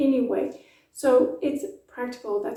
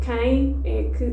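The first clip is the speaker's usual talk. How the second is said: quem é que slow with no pause between words; slow linked demo; 4 dB louder, slower than usual. Shown as a demonstration. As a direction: quem é que joined, nasal E in quem. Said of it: "there's no pause there whatsoever".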